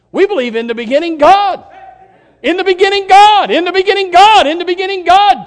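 loud, high refrain, hammering God; preaching at full force. Acted. Preacher proclaiming Genesis to the congregation, full force. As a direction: chant it as a rising creed.

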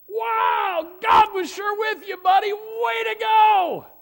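high, loud crowd cheer, slowing down. Retold or imitated. The crowd hailing Elijah as their hero, high loud shout. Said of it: "everybody would say?".

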